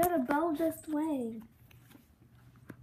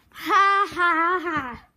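mocking laugh; 3 semitones higher, 8 dB louder, slower than usual. Kids laughing at latecomers.

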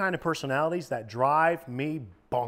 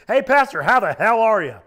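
booming, much higher and louder hearty hello; biker's loud greeting; 7 semitones higher, 11 dB louder than usual. Retold or imitated. New convert biker greets the pastor in the lobby, booming. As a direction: belt it across the lobby like a big tatted biker. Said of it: "The guy goes".

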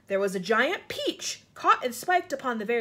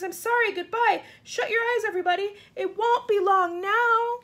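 high, loud farewell, slowing at the end; anguished cry; 10 semitones higher, 7 dB louder than usual. A narrator's reading. James saying goodbye as they fall, anguished cry. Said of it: "cried James".

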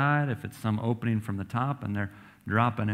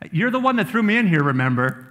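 high, loud, fast mock reproach; teasing Daniel; 8 semitones higher, 8 dB louder, faster than usual. Daniel jokingly reminds the king who threw him in, high and fast. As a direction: rib the king playfully.

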